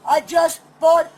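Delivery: drawn-out shouted words; slow loud plea. Real, not acted. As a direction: stretch the words out while shouting. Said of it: "You wanna scream at me some more?".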